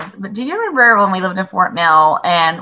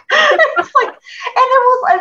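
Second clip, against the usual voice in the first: laughing; slower than usual. Real, not acted. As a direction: laugh it out, pitched way up.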